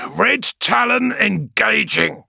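high, loud, slow battle call; a fierce warrior announcing attack; fierce pilot on the vox. Acted. Moore on the vox.

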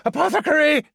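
high, loud urgent yell; shouting over shoulder. Acted. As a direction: shout for help again, desperate. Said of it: "Jaralek shouted over his shoulder again".

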